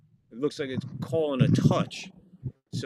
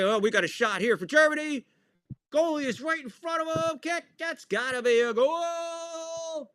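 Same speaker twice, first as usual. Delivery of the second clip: high, building goal call; excited commentary; 14 semitones higher than usual. Real, not acted. Commentator climbing with excitement at a shot on goal.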